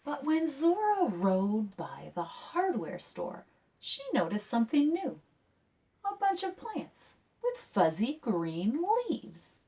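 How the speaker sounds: lively picture-book read; reading to children. A narrator's reading. Author reading her picture book aloud to kids, lively. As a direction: read it bright and expressive.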